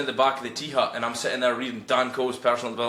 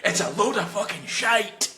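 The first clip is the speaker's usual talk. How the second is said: put-on slow, deliberate blunt old man; a hint of a gruff old man, slow and flat; old man's blunt verdict; 8 semitones higher, slower than usual. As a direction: drag it out like a grumpy old welder. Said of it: "he just paused and looked at me and he went".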